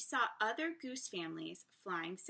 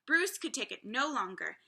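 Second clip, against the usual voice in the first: usual speaking voice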